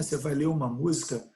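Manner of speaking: ordinary talk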